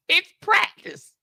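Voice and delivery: high, slow mock Iverson voice; imitating Allen Iverson's practice rant; Allen Iverson quote. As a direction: drawl it like Iverson at the podium. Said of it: "famous words of Allen Iverson".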